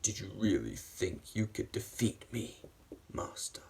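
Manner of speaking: quiet, restrained menace; lightly voiced villain. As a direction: taunt softly in a hint of a villain voice. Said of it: "he said".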